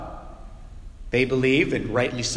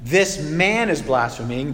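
This man is blaspheming said slowly, each word spaced out; slow deliberate reading. Shown as a demonstration. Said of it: "So if it said".

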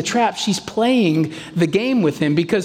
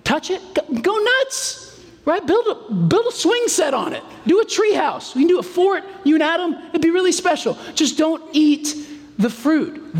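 much higher, excited, bouncy father voice; excited dad suggesting a tree house fort; playful generous God; 9 semitones higher than usual. Retold or imitated. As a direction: urge them on like an eager dad. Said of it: "That was the command".

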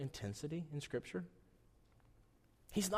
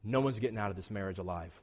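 deadpan joke; 9 semitones lower, 7 dB quieter, faster than usual. Retold or imitated. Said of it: "they say".

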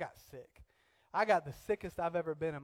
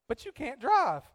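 high, loud imitated objection; mock objection; 10 semitones higher, 10 dB louder than usual. Husband retells wife objecting to his driving, mock objection. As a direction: object sharply in a mildly hammed-up pitch. Retold back-and-forth argument with wife.

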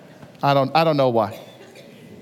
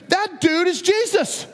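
sudden realization; 12 semitones higher, slower than usual. Retold or imitated. It dawns on Peter.

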